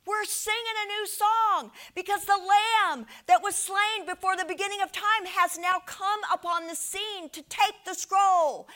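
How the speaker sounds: high, loud heralding cry; exultant proclamation. Retold or imitated. Preacher voices four living creatures announcing a new song, exultant shout. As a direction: proclaim it at full force, building to the end. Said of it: "The four living creatures go".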